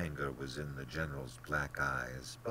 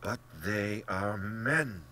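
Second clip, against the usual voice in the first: raised, drawn-out indignation; indignant and insistent, pitched up; hot protest; 6 semitones higher, 6 dB louder, much slower than usual. A narrator's reading. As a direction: object hotly, stretching the words. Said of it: "said Rainsford hotly".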